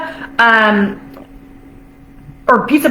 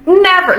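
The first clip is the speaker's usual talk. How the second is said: Never stamped out higher and firmly; a firm, higher Never on its own; emphatic never; 6 semitones higher than usual. Shown as a demonstration. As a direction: drive the single word Never home. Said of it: "Let me repeat that again".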